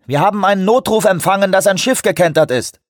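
high, loud voice over the radio; reply through a crackling radio; over walkie talkie. A narrator's reading.

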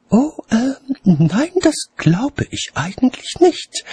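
demur politely in a high register; objecting lady. A narrator's reading. Lady doubting watchman's theory, hesitant high objection. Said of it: "wandte Lady Cassidy ein".

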